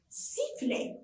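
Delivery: sifflet breathed without voice; whispered word. Shown as a demonstration. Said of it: "picture naming, mimics whistle".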